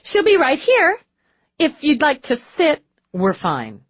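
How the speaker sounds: high forced cheerfulness; strained cheer. A narrator's reading. Receptionist reassuring the detective with a strained smile, forced cheer. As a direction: smile through the nerves.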